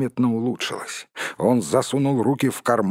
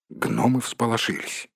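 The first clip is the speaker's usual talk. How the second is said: plain reading voice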